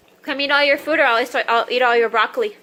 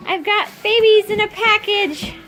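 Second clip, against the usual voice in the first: put-on baby talk; 10 semitones higher, 4 dB louder, much slower than usual. Cooing at babies in box.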